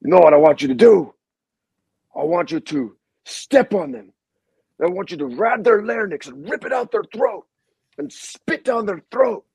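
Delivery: channel a coach who wants blood; imitating intense coach. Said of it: "and he goes".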